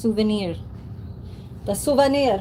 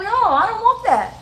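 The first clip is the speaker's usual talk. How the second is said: high, whining refusal; little brother refuses the hamburger, whining; whiny little kid; 11 semitones higher, 3 dB louder than usual. Retold or imitated. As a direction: refuse like a stubborn six-year-old. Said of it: "I'd be like".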